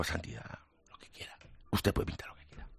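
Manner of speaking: hushed near-whisper, barely voiced; nervous hushed reply, holding back. Painter answers the Pope in a nervous hushed near-whisper. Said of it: "conteniéndome".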